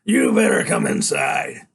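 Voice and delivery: low, loud, slow threat; deep menacing biker. A narrator's reading. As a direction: growl the order. Biker orders.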